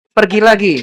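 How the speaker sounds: loud, high acted retort. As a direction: act out an irritated spouse raising the voice. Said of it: "bisa lebih tinggi lagi".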